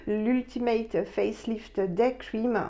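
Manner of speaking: slow, careful English accent; hesitant English-accented label reading. Real, not acted. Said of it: "j'ai horreur de prendre un accent anglais".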